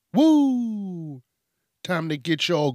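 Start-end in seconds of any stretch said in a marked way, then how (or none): 0.1-1.2 long, high whoop gliding down; excited whoop. Real, not acted. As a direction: let out a celebratory "woo!". Excited cheer for upcoming Thanksgiving.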